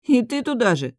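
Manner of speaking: aghast outburst; horrified. A narrator's reading. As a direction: recoil in dismay, pitch up. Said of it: "ужаснулся Руру".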